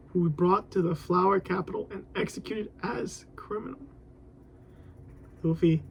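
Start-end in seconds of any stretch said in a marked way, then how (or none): 0.1-3.8 whispered line. Acted. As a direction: read it in a hush. Whispered reading.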